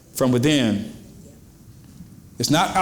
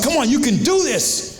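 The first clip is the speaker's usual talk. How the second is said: higher, rousing encouragement; cheering crowd; 10 semitones higher than usual. Retold or imitated. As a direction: cheer them on to get up. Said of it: "cheering you on".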